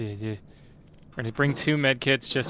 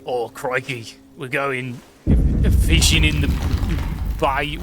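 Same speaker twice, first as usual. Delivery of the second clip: broad, hammed-up Australian accent; comedic Australian accent; 6 dB louder, slower than usual. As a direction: do a jokey Australian on request. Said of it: "Do an Australian accent?".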